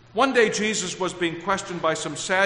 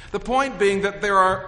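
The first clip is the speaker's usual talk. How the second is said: usual speaking voice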